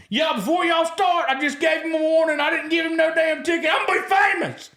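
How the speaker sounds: the cop filming himself for social media, high and shouting; thrilled cop. Retold or imitated. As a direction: shout it to the camera like a giddy fan.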